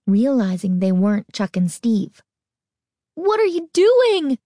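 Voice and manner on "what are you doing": high, urgent woman's voice; alarmed mother, high and insistent; breathless demand. A narrator's reading. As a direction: demand an answer, pitched high. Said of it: "the woman repeated breathlessly".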